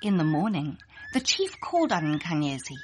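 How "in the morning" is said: ordinary delivery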